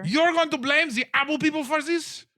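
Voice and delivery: high, loud frantic scolding; stomping mayor berating Cappy; furious mayor. Acted. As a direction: rant at him like a ruined politician. Mayor incredulous.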